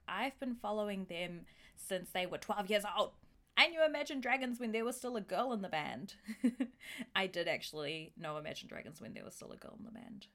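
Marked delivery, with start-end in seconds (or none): 0.1-6.1 bragging early fan. Acted. Hip listener claims an artist before TikTok, mock bragging. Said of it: "you'll be like". 6.2-7.1 short chuckle; amused laugh. Real, not acted. Host amused by her mock early fan, short chuckle.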